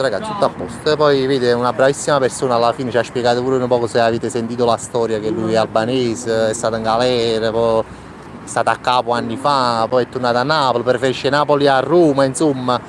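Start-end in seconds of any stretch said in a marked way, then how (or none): none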